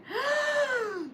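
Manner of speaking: sharp high startled gasp. Acted. Baby Bear spotting the girl, high startled gasp. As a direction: gasp like a frightened cub.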